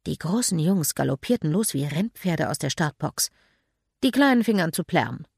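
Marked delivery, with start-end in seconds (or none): none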